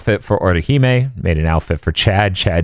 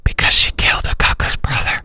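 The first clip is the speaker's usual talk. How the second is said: dramatic whisper; 14 semitones higher than usual. Pretend. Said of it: "dramatic whispered aside".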